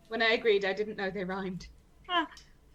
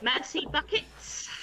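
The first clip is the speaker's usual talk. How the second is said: slow, higher mock French accent; mimicked French accent; 5 semitones higher, slower than usual. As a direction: drag it out in deliberately bad French. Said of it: "could you all mimic her?".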